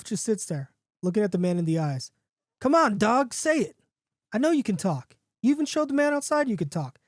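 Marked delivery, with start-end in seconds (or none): 2.6-7.0 high-pitched, pressing coaxing; insistent urging. A narrator's reading. Man to the dog that won't talk, insistent urging. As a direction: push a stubborn animal to perform.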